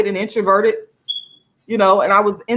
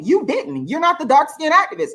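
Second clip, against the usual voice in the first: usual speaking voice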